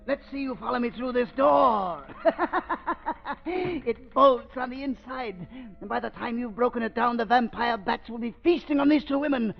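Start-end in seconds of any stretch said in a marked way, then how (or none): 2.1-3.4 sinister laugh. Acted. Villain gloats at locked door. 8.4-9.5 rising higher and higher into a triumphant shout; building villain rant. Acted. Villain rants about the fortune.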